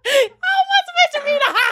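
high, laughing shout; can barely get the words out for laughing; laughing hard. Real, not acted. Mocking the listener's written ha ha, laughing shout. Cracking up over letter.

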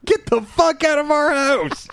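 high, slow mock shout of get out; mock outraged yell. Friend mocking the episode with a high, slow outraged yell. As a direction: ham up an outraged get out of our house. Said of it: "a series of".

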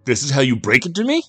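high, loud, fast outrage; spat in anger. A narrator's reading. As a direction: fire it off in loud disbelief. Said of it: "Jack spat into the phone".

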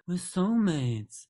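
slow, drawn-out dreamy soulmates; mock soppy. Mocking a new boyfriend's gushing line, slow and dreamy. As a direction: drag it out like a lovesick fool. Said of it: "look her in the eye and go".

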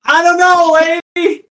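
loud, high crier voice answering back; town crier. Acted. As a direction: shrug it off in the crier's voice. Crier answers heckler, still in voice.

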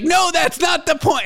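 high, slow mock shout of refusal; hammed-up stubborn husband digging in; defiant husband. Husband refusing wife's plea to pay, high slow mock shout.

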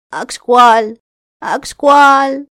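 loud, high wailing cry. Acted. Raven wailing the deer's name in pain, loud. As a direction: wail the name in mock pain. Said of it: "άρχισε να φωνάζει και να σκούζει".

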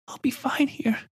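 put-on very high, soft child voice, resigned; a hurting girl pretending she's fine; brave little girl. A narrator's reading.

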